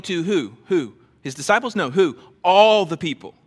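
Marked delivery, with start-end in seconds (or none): 2.4-3.4 loud, emphatic answer. Real, not acted. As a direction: lift your voice on Everyone. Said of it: "so emphatic about it".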